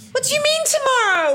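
high, fast panicked question; the dad caught out at the last minute; high startled parent, rushed; alarmed disbelief. Retold or imitated.

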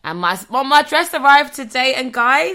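high, loud gushing; excited announcement. Real, not acted. Telling listeners her birthday dress arrived, excited. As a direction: burst out with the big news.

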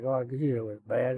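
normal talking voice

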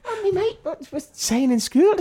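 high excited mock girl voice; daughter's voice. Dad quotes daughter's school gossip, high mock girl voice. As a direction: imitate an excited young daughter.

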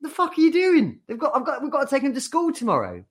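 very high, loud shriek, rushing fast at the end; mock screeching wife. Wife yelling at husband about school, mock shriek. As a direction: shriek at him in disbelief. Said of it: "she's just like".

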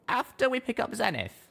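high, plain little mare voice; a small pony chatting; high little pony voice, chuckling aside; young mare. A narrator's reading.

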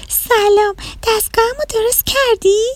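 put-on high, soft childlike rabbit voice; little rabbit. Little rabbit telling the mouse, high sad child voice.

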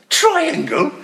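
high, loud, disbelieving echo; laughing, scandalised question; mock incredulous. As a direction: balk at a ridiculous suggestion.

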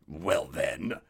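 low menacing wolf. A narrator's reading. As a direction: growl it as the wolf. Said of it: "said the wolf".

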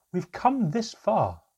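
rally the tired group; urging. A narrator's reading. Mother urging the group not to give up, loud.

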